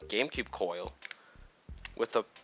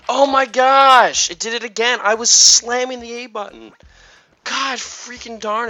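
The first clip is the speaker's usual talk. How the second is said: high-pitched, loud exasperated yelling; frustrated outburst; 13 semitones higher, 15 dB louder than usual. Real, not acted. Gamer yelling at the controller after a missed catch, frustrated outburst. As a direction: blow up at the game for failing you again.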